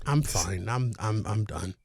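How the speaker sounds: low, very quiet grumble; subdued, worn-out irritation; deflated, spent. Real, not acted. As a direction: wind down after a rant, low and soft. Winding down after the meltdown.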